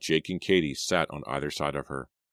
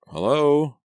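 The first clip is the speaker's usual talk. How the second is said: ordinary delivery, nothing marked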